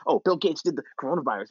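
imitating an alarmed, paranoid video narrator; mock frantic. Skeptic imitating conspiracy video makers, mock frantic. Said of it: "If they sound all frantic".

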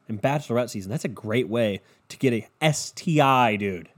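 usual speaking voice

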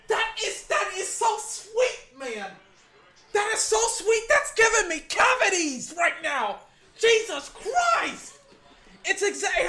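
overjoyed fan yelling at the screen; ecstatic shout. Real, not acted. Fan reacting to a big play.

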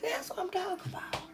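fast high whisper. Real, not acted. Host to viewers in a quick knowing whisper. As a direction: hiss it as a knowing aside.

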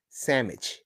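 "sandwich" said as SAM-itch; slang pronunciation of sandwich. Shown as a demonstration. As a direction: swap the nd in "sandwich" for m. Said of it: "we have the tendency to say sandwich".

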